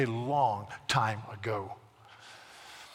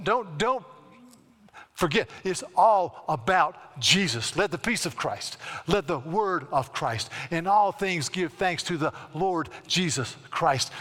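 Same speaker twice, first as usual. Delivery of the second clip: slow, louder preaching voice; 4 semitones higher, 5 dB louder, slower than usual. Acted. Pastor preaching to congregation, slow and forceful. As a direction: drive the verses home.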